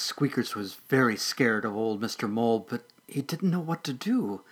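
usual speaking voice

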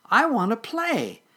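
high, loud little kitten voice; insistent kitten. Acted. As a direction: demand playtime in a high voice.

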